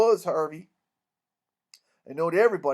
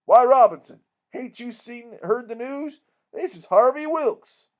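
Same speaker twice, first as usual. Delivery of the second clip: higher, emphatic protest; astonished reproach; 5 semitones higher, 7 dB louder than usual. Acted. Abner Shackelford introducing Harvey to the doctor, astonished. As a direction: break the news as if he must have missed it.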